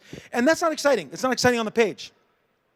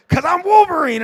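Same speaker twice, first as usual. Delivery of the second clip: higher, louder, shouted; dramatic reveal; 8 semitones higher, 6 dB louder than usual. Retold or imitated. Cable declaring himself Wolverine, hammed-up shout. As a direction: belt it like a big comic reveal. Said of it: "Cable turns and says".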